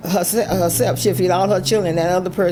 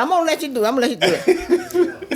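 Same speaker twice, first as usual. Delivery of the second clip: laughing friend; 10 semitones higher, much faster than usual. Retold or imitated. Friend agreeing to be prayed for.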